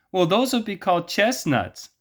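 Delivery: high falsetto woman in a joke; woman's voice. Retold or imitated. Woman in the joke answering the man, high falsetto. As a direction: squeak it up as the woman. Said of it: "what would you call those".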